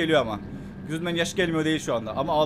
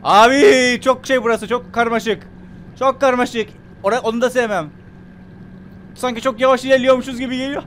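high, loud, agitated complaint; worried, high-pitched wailing; nervous alarm; 8 semitones higher, 7 dB louder than usual. Real, not acted. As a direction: raise voice and pitch in mounting dread. Nervous in confusing deep area.

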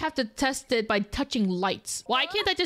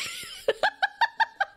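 high cackling laugh. Real, not acted. Player laughs at slow vehicle.

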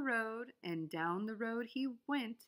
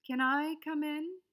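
higher hopeful question; bunny asking; 6 semitones higher, 4 dB louder than usual. A narrator's reading. Bunny asking the groundhog, higher and hopeful. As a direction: ask hopefully. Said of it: "said the bunny".

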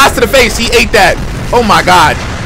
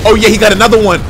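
ordinary talk, nothing marked

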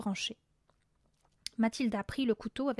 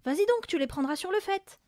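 high, louder prodding; urgent goading; 8 semitones higher, 5 dB louder than usual. A narrator's reading. Mother sending husband after burglars, urgent goading. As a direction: push them into action.

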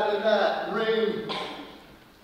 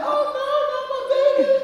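high, louder pleading woman's voice; mock mother's cry; 6 dB louder, faster than usual. Rhea begging Kronos to spare her baby, high pleading. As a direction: squeal it like a frantic mom. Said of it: "And she said".

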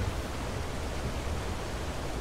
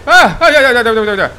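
sharp, high, loud cries of alarm; panicked yelps; 6 semitones higher, 5 dB louder, faster than usual. Real, not acted. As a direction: yelp in sudden panic. Attacked in the game, cries out.

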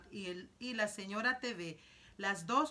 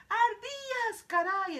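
much higher and loud, amazed cry; excited exclamation; 13 semitones higher, 8 dB louder, slower than usual. Acted. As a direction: yell out in sheer amazement at the squirrels. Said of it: "exclamó Mike T V". (exclamación muy aguda y fuerte de asombro)

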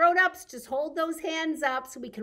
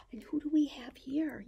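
hushed secret whisper. Acted. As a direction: lean in and whisper the question. Hushed question to children.

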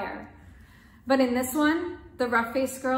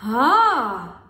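excited exclamation; 9 dB louder than usual. Acted. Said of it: "exclaimed the sister in excitement".

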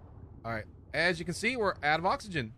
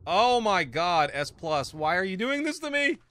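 louder, higher frustrated plea to the game; exasperated; 5 semitones higher, 7 dB louder than usual. Real, not acted. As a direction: beg the game to stop tormenting you. Game keeps failing the player.

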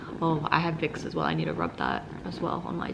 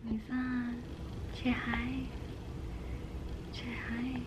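soft coaxing; 7 semitones higher, 10 dB quieter, slower than usual. Real, not acted.

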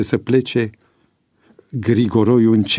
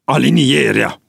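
raised, angry command to line up; angry order; 4 semitones higher, 4 dB louder than usual. A narrator's reading. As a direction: order the ranks sharply. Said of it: "porunci el cu mânie". (poruncă mânioasă)

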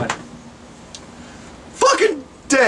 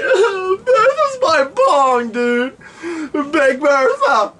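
high wailing blubber; mock crying; 6 semitones higher, slower than usual. [name] explaining Bertha is his bong, sobbing. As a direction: sob it out like losing a loved one.

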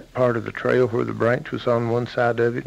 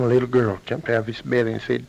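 ordinary delivery

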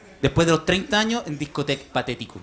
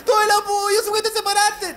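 put-on much higher, squealing girlfriend voice; gushing girlfriends; 12 semitones higher, slower than usual. Friends phoning the newly separated woman, high gushing girlfriend voice. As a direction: gush like an overexcited best friend. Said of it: "todas la llaman".

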